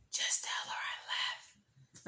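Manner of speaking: slow, urgent whisper imitating the date; whispering in ear. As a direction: whisper it secretly into someone's ear. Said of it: "he's right here in my ear".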